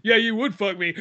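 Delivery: ordinary delivery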